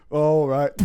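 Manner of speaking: ordinary talk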